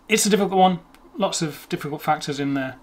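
ordinary talk, nothing marked

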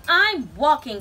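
loud, irritated snap; snapping. A narrator's reading. Grumpy Bird answering Raccoon, snapping. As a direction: snap back with emphasis. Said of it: "snapped Bird".

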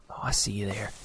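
hushed sneaky whisper. Acted. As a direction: whisper it to your prey. Sneaking up on an animal.

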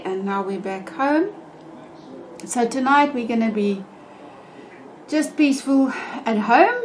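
ordinary delivery

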